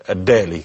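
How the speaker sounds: الدالي said with an initial D in place of J; sound shift to D. Shown as a demonstration. Doctor showing how Upper Egypt changed الجالي, D-sound pronunciation. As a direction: say الدالي, opening on a clear d sound. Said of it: "قلبت".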